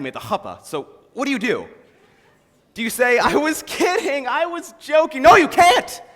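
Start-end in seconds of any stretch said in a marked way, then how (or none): 3.2-5.2 high, drawn-out backpedalling; pleading excuse. Retold or imitated. Groom imagines backing out at the altar, pleading excuse. 5.2-6.0 high, loud burst of protest; emphatic outburst. Acted. Man rejects backing out of the wedding, emphatic outburst.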